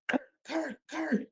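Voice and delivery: high, hushed imitation of a yell; mock distant yell. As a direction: call the name out high but keep the volume down. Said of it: "I hear my dad yelling my name".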